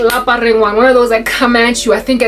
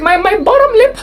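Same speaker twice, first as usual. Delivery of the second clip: high, wailing mock-teacher voice; hammed-up tearful high pitch; Missus Pepper impression; 13 semitones higher, slower than usual. Student mimicking teacher on the brink, mock wail. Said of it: "she was like".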